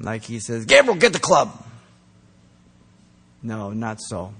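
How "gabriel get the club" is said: mock stern policeman god. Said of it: "God being some policeman just ready to whack them".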